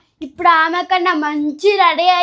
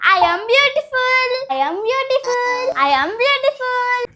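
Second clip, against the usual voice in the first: higher, slow, drawn-out sing-song boast; over-the-top showing off; 6 semitones higher, much slower than usual. Acted. [name] overacting her beauty to her sister, higher and slow. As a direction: preen like a diva who knows she's gorgeous. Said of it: "over action చేస్తున్నావు జాహ్నవి".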